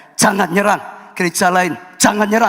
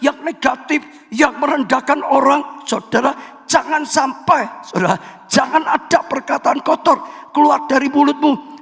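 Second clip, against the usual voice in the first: raised, pressing sermon voice; preaching at full force; 7 semitones higher than usual. Real, not acted. Pastor warning the congregation about dirty words, fervent preaching. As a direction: drive the warning home to the pews.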